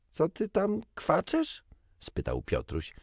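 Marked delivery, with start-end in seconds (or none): 0.1-1.6 put-on higher, teasing boy's call across the water; mocking boy. A narrator's reading. Peter calling to the bird, higher and teasing. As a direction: tease the bird like a cheeky boy.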